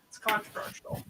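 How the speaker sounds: breathy whisper, no voice. Shown as a demonstration. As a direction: whisper it like a secret. Repeats the line, then whispers it.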